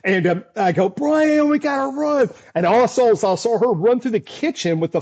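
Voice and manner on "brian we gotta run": urgent call. Retold or imitated. Calling to cameraman in tornado.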